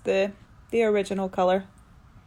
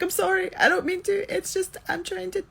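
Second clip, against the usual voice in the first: whiny apology; 12 semitones higher than usual. Retold or imitated. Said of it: "And I'm like".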